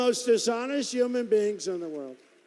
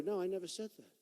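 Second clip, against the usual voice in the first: ordinary delivery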